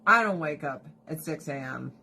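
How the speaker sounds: normal talking voice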